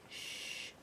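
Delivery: soft breathy shush; hushing. A narrator's reading. Spider hushing frightened James, soft shush. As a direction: hush him gently. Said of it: "said the spider".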